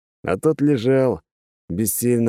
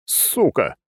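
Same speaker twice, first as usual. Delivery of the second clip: sharp curse; 6 semitones higher than usual. Acted. Swearing at sudden explosion.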